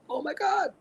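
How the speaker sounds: freaking out. Retold or imitated. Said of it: "she started freaking out".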